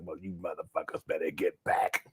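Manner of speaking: hushed menacing whisper; whispered threat. Retold or imitated. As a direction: breathe the threat out low. Granny archer warning retold.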